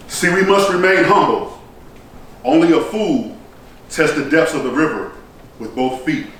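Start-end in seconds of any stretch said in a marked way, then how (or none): none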